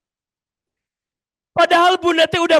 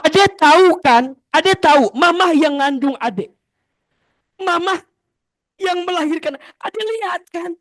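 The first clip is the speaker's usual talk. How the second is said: lecturing mother; 5 semitones higher, 5 dB louder than usual. Retold or imitated.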